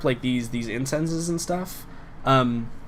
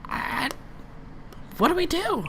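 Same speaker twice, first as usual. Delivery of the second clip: small, very high, hesitant start; high-pitched creature; 6 dB quieter than usual. Acted. Unseen creature asks companion for a plan, high-pitched. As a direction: pipe it high and softly. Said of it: "She's like".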